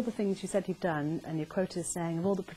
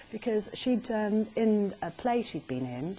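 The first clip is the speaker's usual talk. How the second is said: ordinary talk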